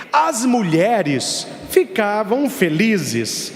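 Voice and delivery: usual speaking voice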